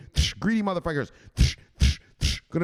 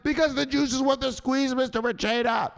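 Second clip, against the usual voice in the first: high-pitched, adoring pledge; gushing put-on pupil voice; follower loving it; 9 semitones higher than usual. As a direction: recite it like you love it. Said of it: "Say it like you love it".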